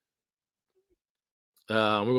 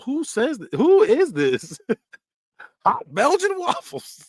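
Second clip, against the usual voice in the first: much higher, slow cheery put-on voice; mock happy voice; 14 semitones higher, 5 dB louder, slower than usual. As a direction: sing it out overly happy. Mocking cheerful voice.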